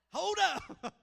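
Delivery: high loud plea for a break; exasperated shout. Retold or imitated. Tired dad to daughter, loud exasperated shout. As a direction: beg for a second, loudly. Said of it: "And I'm just like".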